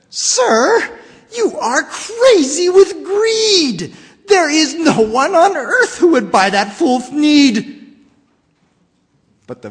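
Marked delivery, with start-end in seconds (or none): 0.1-7.9 higher, louder shout with "trees" punched; Lorax shouting. Acted. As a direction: yell it, hammering "trees". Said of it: "The Lorax said".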